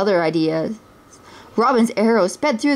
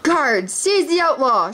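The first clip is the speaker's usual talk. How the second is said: mild shout; 6 semitones higher than usual. A narrator's reading. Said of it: "shouted Prince John".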